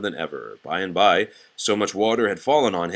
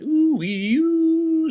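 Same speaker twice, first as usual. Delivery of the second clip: high siren wail; ooh-wee-oo siren call; 5 dB louder than usual. Acted. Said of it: "squealed his siren".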